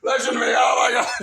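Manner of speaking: high panicked yelling; shrieking in alarm; frantic shout. Retold or imitated. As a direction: scream it in full panic. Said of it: "he's like".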